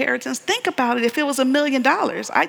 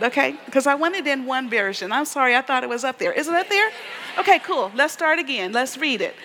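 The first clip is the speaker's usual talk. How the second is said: usual speaking voice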